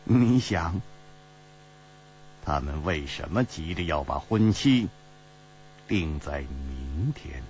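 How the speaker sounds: low, softening, deliberate question; deep, grave voice. A narrator's reading. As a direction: ask gravely, dropping low and drawing out the end. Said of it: "沉声说".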